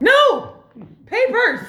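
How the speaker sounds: high, loud, exasperated correction; alarmed shout. Acted. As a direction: shout it to stop a misunderstanding. Stops Honey misinterpreting the demand.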